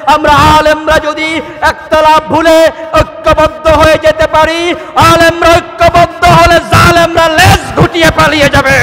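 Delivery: high, very loud rallying shout; preaching at full force. Real, not acted. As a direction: rouse the crowd with a sustained high-pitched cry. (উচ্চকণ্ঠে জ্বালাময়ী was)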